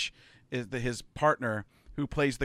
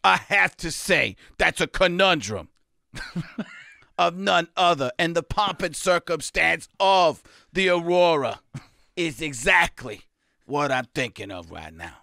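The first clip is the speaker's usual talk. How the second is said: loud, higher mock-scientific pundit rant; Stephen Hawking as pundit; 4 semitones higher, 6 dB louder than usual. Host plays a science-pundit mashup, loud and pompous. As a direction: pile on big words like a pompous pundit. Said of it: "Stephen A. Hawking".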